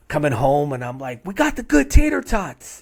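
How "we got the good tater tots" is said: high, loud mock-excited announcement; excited boast. Broke dad coming home with groceries, high excited boast. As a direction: crow it way up high.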